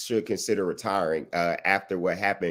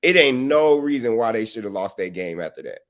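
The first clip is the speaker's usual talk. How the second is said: usual speaking voice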